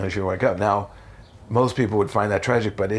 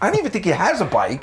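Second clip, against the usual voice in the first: incredulous rant; 5 semitones higher, faster than usual. Retold or imitated. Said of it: "And I'm like".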